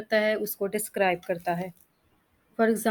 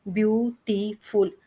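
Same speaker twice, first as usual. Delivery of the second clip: Beautiful slowly split into three beats, ब्यू-टी-फुल; syllable by syllable; slower than usual. Shown as a demonstration. Teacher showing three syllables, beautiful said slowly in separate parts. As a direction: break beautiful apart with a gap after each syllable. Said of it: "तो इसके three syllable है".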